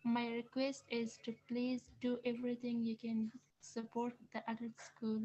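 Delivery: ordinary delivery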